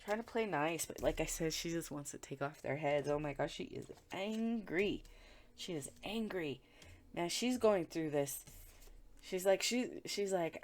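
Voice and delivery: ordinary talk, nothing marked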